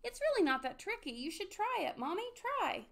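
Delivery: put-on high, eager child's voice; excited little child. A narrator's reading. Pip-Pip urges Mommy to try flying, excited cry. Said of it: "cried little Pip-Pip".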